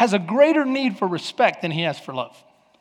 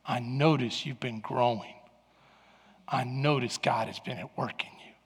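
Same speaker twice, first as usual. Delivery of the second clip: much softer, low and tender; tender praise; 6 semitones lower, 9 dB quieter than usual. Retold or imitated. Wife softly affirming her husband. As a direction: praise him gently and sincerely. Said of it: "compliments a wife can give her husband".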